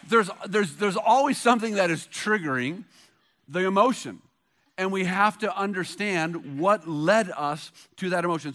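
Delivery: ordinary talk, nothing marked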